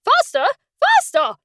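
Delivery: high sharp repeated cries; Queen crying out. A narrator's reading. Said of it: "cried the Queen".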